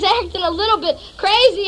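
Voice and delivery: ordinary delivery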